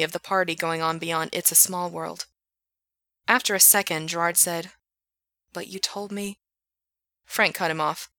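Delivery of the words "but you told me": hurt, quiet. Acted. Gerard hurt and small.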